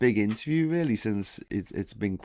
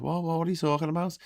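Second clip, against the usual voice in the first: higher, hurried protest; quick, mildly indignant Scouser querying it; 7 semitones higher, much faster than usual. Acted. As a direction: rattle it out in disbelief. Acted indignant Scousers at gate.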